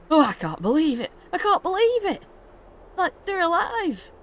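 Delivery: very high, strained disbelief; hammed-up emotional father, pitch soaring; overwhelmed relief. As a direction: hold on to a child you thought lost. Father acted, clinging to rescued child.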